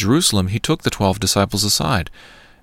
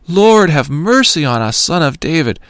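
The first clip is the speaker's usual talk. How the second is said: crying out even louder; 7 semitones higher, 5 dB louder than usual. A narrator's reading. Blind men shouting past the crowd that rebukes them, a desperate loud plea. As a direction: shout it over the crowd trying to hush you. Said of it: "cried out all the more".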